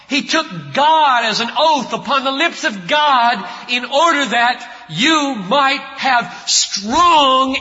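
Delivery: loud, slowing to a crawl; preaching at full force. Acted. Preacher urging the congregation, loud and slow. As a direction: hammer the words slowly.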